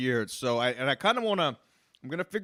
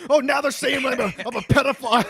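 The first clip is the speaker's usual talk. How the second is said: high, loud mock-outraged yell; 10 semitones higher, 6 dB louder, faster than usual. Podcaster imitating the streamer's reaction to being baited, high mock yell. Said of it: "so many fucking yelling".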